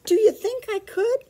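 high little puppy voice, hopeful; a small dog asking, eager and high. Acted. Petey asking his mother, high and hopeful.

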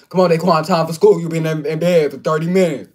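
loud high drill sergeant wake-up; uncle waking him. Retold or imitated. Nephew imitating uncle waking him for school, loud and high. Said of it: "He'd be like".

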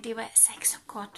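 fast hushed whisper to the listener; whispered aside. Real, not acted. Reader whispering an aside to the child, hushed. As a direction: lean in and whisper the comment.

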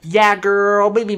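mock flamboyant diva talk. Shown as a demonstration. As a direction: camp it up, over the top. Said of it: "the flamboyant voice, the whole hardcore".

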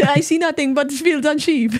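high mocking Welsh accent; mock Welsh lilt, pitched high; mimicked Welsh accent. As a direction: tease him by parroting his Welsh accent. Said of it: "That's all I hear".

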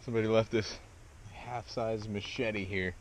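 usual speaking voice

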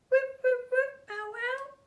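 very high excited chirped guesses; a tiny bird hopeful guessing; high squeaky whips. Acted. Bird guesses magic word.

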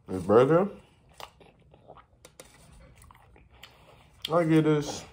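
talking with food in mouth; mumbling while chewing. Real, not acted. Seen 0: fry in hand, cheeks look full before, during and after.

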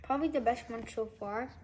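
chewing between words; talking while chewing. Real, not acted. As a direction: mouth partly full of wafer. Seen 0: Bites wafer at -7; lips still working early in the labelled words.

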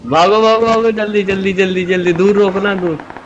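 high-pitched, repeated urging; urgent escape orders. Real, not acted. Player telling driver to escape and stop far off, urgent high voice. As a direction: hurry the driver away with excited, tumbling repeats.

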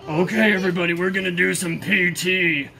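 loud military instructor voice; imitating deployment NCO. NCO announcing P T to everybody, loud imitation. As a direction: announce it loudly to the troops. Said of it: "he used to say".

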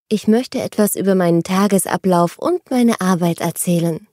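usual speaking voice

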